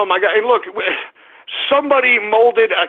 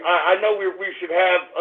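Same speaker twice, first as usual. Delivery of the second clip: ordinary delivery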